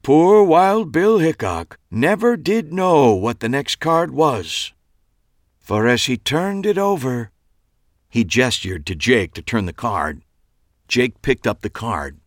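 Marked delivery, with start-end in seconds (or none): 0.0-7.3 high theatrical build-up to the reveal; dramatic storyteller. A narrator's reading. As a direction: lead the audience toward the shock. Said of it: "dramatic pause and then continued".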